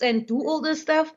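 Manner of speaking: ordinary delivery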